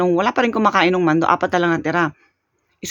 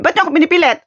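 high, louder mock angry retort; imitating angry grandma; 10 semitones higher, 4 dB louder, faster than usual. Grandma angrily asking why they pressure her, imitated high. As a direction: snap back like an offended grandma. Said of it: "Ang galit talaga sa amin".

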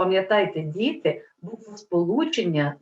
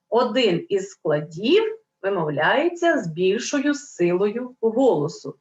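ordinary delivery

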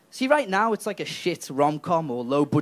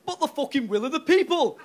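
higher, whiny complaining voice; mock protest; 8 semitones higher than usual. Stoke complaining to London, higher and whiny. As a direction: protest like a wronged town. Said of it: "Stoke's gonna go".

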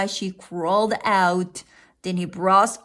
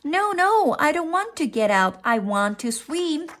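put-on protesting child; 7 semitones higher than usual. A narrator's reading. Froggy refusing to leave the water, high protest. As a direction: insist in a raised little voice.

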